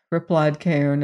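ordinary delivery

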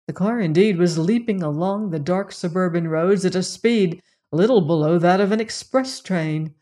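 plain reading voice

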